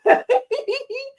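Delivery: high-pitched giggle. Real, not acted. Host tickled by the word freak, giggling. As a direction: let out a high little giggle.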